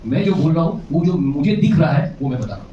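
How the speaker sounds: normal talking voice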